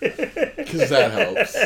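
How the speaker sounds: high, slow, laughing sarcasm; sarcastic. Acted. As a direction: drag it out mockingly. Sarcastic retort while laughing.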